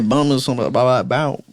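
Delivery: low, quick, garbled mumble; mock mumbling. Friend imitates Creole as just mumbling, low quick mock mumble. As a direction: run the words together into gibberish. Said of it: "like they're just mumbling".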